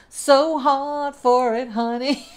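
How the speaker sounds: playful diva sass; sassy honey. Acted. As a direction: tease the listener with a cheeky honey. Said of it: "I'm incorrigible".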